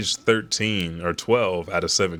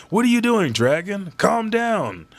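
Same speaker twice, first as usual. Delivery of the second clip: much higher, louder shout; alarmed shout; 12 semitones higher, 3 dB louder than usual. Retold or imitated. A soldier shouting at Dragon to calm down, high and loud. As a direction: yell up high to stop a comrade.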